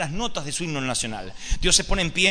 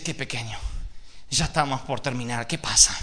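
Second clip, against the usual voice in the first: tender father; 5 semitones lower than usual. Acted. Abraham softly speaks to son.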